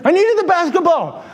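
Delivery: high-pitched imitated excuse; mock kid protest; defensive kid. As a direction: plead like a put-out youngster. Kid's excuse acted out.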